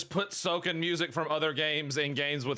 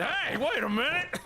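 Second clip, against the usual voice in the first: mock boss protest; 10 semitones higher than usual. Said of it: "And he said".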